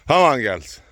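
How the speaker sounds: loud call to cattle. Real, not acted. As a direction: call the herd in. Calling cattle up the hill.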